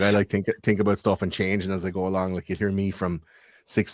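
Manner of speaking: ordinary talk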